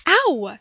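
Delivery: loud, sharp ow; pained yelp. Acted. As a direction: yelp at the slap. Being spanked.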